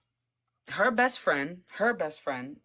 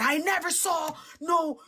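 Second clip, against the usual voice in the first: freaked-out friend; 10 semitones higher than usual. Retold or imitated. And she was freaking out.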